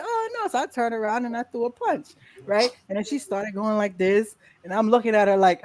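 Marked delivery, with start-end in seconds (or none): 0.0-0.5 mock alarm. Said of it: "and I was like".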